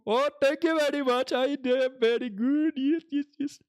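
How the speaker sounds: cartoon Indian accent, overly polite; mock Indian accent. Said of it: "my friend Apu".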